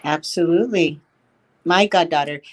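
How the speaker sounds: ordinary talk, nothing marked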